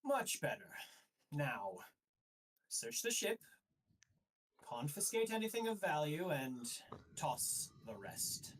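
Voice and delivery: satisfied official turning to orders; approve, then issue the search order; commanding inquisitor. Acted. High Inquisitor orders his men to search, commanding voice.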